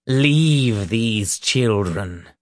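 slow, grave order; exorcist's measured command, unraised in pitch; stern command. A narrator's reading. Reverend orders the demon from the boys, slow and stern. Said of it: "stern voice".

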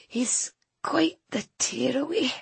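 words torn from the throat; strained slow whisper. A narrator's reading. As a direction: force each word out weakly. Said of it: "said Missus Clark slowly".

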